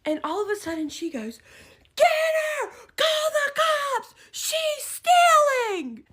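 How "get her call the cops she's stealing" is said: drawn-out, very high loud shriek; imitated yelling accuser. Woman barreling round the corner, imitated shrieking accusation. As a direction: raise the alarm, stretching each cry. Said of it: "the lady that was yelling".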